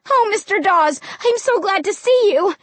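high-pitched, relieved greeting; happy cry. A narrator's reading. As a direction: gush with relief. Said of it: "I cried happily".

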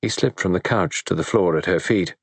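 usual speaking voice

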